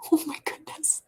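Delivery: high, soft, breath-only gasp of words; aghast whispered reaction; hushed dismay. Retold or imitated. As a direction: whisper it in disbelief. Older sister's retold dismayed reaction.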